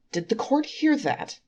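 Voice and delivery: accusing angel cries out to the court, high and loud; triumphant cry. Acted. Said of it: "he cried".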